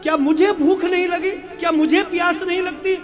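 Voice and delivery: mother scolds her son for ignoring the meal; angry mother. Acted. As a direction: rage at the son who won't come eat. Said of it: "अब मैया को क्रोध आ गया".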